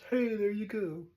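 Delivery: bright, unhurried hey there; cheery hey. Retold or imitated. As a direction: greet it like an old friend. Casual greeting to lucky numbers.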